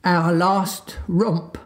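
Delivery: slow, drawn-out mournful groan. Acted. Father sighs over the children's last romp, slow and mournful. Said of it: "Mister Darling groaned".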